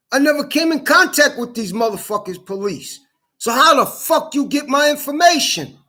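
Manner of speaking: high-pitched, indignant protest; outraged rant. Real, not acted. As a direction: push the voice up in real anger. Ranting about leaked personal information.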